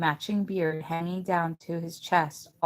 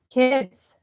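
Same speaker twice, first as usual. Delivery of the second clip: loud high call from the back door; 6 semitones higher, 9 dB louder than usual. A narrator's reading. Missus Dickerson calls the kids in from her back door, loud high call. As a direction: call the kids in across the yard. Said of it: "calling us from her back door".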